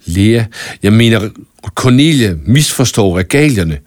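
usual speaking voice

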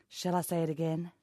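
normal talking voice

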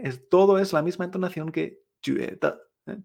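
normal talking voice

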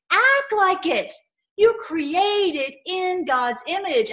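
slow, high stern command. Acted. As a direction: lay down the command slowly. God commanding his sons.

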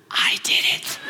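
guilty whispered confession. Retold or imitated. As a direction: whisper it as if someone might hear. Wife confesses secret in closet.

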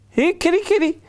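very high sing-song call; calling the cat. Acted.

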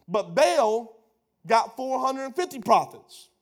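loud, high-pitched preacher thunder; fiery preaching. Acted. Preacher voicing Elijah challenging the people, loud. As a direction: hurl Elijah's challenge at the crowd.

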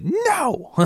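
high cry of protest; dramatic no. Retold or imitated. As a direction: wail it out in dismay. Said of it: "this is the exact moment where I went".

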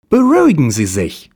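hammed-up soothing private eye; detective voice. Detective calming the client.

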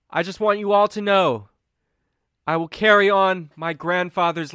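high, projected orator voice; public speech. Acted. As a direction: address the crowd with measured pauses. Colm gives speech to crowd.

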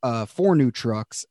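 Southern lotto winner listing purchases; hammed-up Southern twang; Applejack Southern accent. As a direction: brag like a Southern big spender. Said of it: "the Southern accent was actually Applejack".

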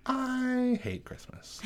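high, slow, put-on voice; mock Hillary line. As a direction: stretch it out in a silly high voice. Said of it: "it would say".

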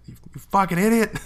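high, fast mock snap; angry insult. Shown as a demonstration. Partner yelling at a climber, quick high mock snap. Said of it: "is gonna do better than".

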